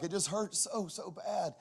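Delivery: high-pitched mock sobbing complaint; imitating sobbing son. Son breaks down to his dad, imitated tearful wail. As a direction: imitate a boy wailing through tears. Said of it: "just a full-blown sob".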